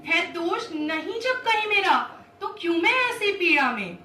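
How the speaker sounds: loud, accusing appeal to the elders; impassioned Draupadi. Acted. Draupadi pleads with Bhishma and the court, impassioned. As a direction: cry out in wronged protest, pitch climbing on questions.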